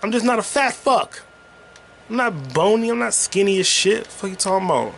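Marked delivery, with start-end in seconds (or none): 0.0-1.2 irritated comeback; indignant retort. Real, not acted. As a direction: fire back high and offended.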